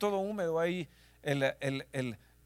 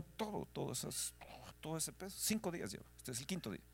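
hushed whisper; 12 dB quieter than usual. Real, not acted. Pastor telling the congregation of his wife's weight, whispered. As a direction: whisper it, heavy with grief.

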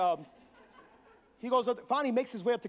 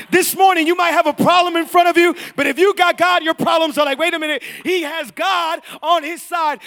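loud, raised preacher's voice; full-force preaching; 5 semitones higher, 13 dB louder than usual. Real, not acted. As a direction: fire up the congregation.

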